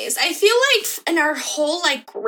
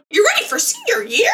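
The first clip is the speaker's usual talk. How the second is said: mock parent voice; 9 semitones higher, faster than usual. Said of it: "they're all looking at us like".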